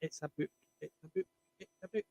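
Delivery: keeping the last pick a secret, very soft; teasing hush. Real, not acted. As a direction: tease the reveal under the breath. Teasing build-up, repeated.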